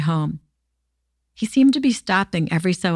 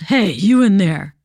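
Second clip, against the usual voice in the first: louder menacing rasp from a stranger; raspy growl; 4 dB louder than usual. A narrator's reading. Raspy stranger calling into the dark truck window, growling. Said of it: "a raspy voice growled".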